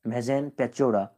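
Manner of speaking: normal talking voice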